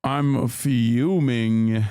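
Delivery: very slow, drawn-out deadpan fake fury; sarcastic mock fury. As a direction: stretch it out, mocking the idea you're angry. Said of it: "my face of, yeah, unbridled rage".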